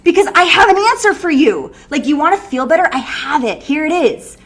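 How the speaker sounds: loud, high, commanding; doctor shouting the cure; forceful doctor. Retold or imitated. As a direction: demand they take it now. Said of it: "he's gonna be like".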